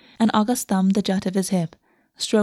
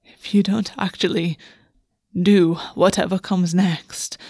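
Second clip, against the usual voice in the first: slow, formal and breathy; a lightly voiced haughty prince, drawn out; regal lover's demand; slower than usual. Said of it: "the Raven Prince said".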